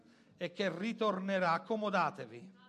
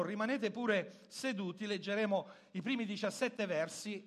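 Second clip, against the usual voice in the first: ordinary talk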